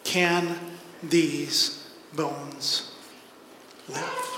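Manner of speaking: slow, low and soft, each word set apart; hushed solemn question. Acted. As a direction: ask it quietly, with long weighty pauses. God's solemn question read out.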